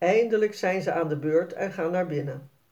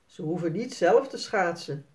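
ordinary delivery, nothing marked

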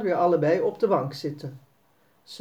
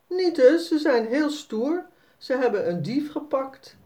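lightly voiced little girl, protesting, higher; a young girl objecting brightly; hint of an indignant little girl; child voice; 7 semitones higher than usual. Narrator voices Sanne protesting.

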